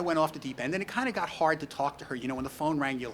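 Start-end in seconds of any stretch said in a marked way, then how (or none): none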